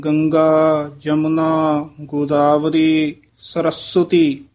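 a clear pause after each river name; careful pauses. Shown as a demonstration. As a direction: say each name apart, slowly. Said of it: "ਇਹ ਵਿਸਰਾਮ ਧਿਆਨ ਨਾਲ ਲਾਉਣਾ ਹੈ". (ਹਰ ਨਾਂ ਮਗਰੋਂ ਵਿਸਰਾਮ)

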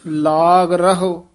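ਲਾਗ ਰਹੋ read together slowly; ਲਾਗ ਰਹੋ joined, slowly. Shown as a demonstration. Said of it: "ਇਹ ਸੰਬੰਧਕ ਪਾਠ".

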